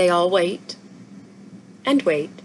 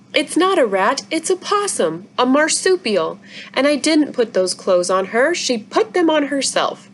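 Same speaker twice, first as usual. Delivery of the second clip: put-on young girl voice; 6 semitones higher than usual. Izzy defends the possum.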